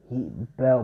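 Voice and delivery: usual speaking voice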